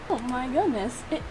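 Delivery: ordinary delivery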